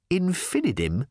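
high, rising query; incredulous echo. A narrator's reading. Reporter questions the new company name, incredulous.

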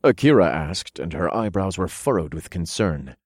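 ordinary delivery

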